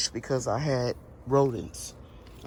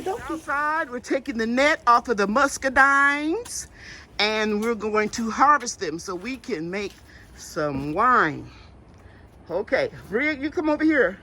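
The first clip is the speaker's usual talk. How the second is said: bright, higher, lively mom voice; cheerful higher voice; 9 semitones higher, 3 dB louder than usual. Real, not acted.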